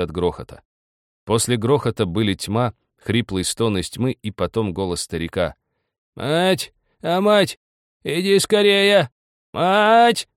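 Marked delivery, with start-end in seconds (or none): 6.2-10.3 very high, loud drawn-out calls; old man screaming for his wife to come; desperate yell. A narrator's reading. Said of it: "вдруг заорал он отчаянно".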